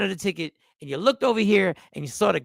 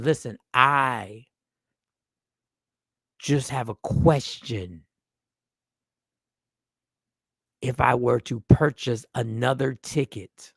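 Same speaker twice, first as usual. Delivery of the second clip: low, very slow, halting delivery; drawn-out, deep, cautious question; careful caller; 8 semitones lower, slower than usual. Shown as a demonstration. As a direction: tread carefully, with long pauses between phrases.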